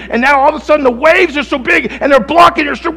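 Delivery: loud, high impassioned sermon climax; fiery preacher in full flight; preaching at full force. Acted. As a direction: preach it out over the storm.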